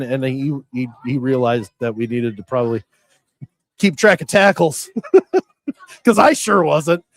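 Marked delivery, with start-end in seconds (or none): 3.8-7.2 higher pitch with chuckles between phrases; amused, laughing. Real, not acted. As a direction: laugh at your own slip. Joking about own missed job.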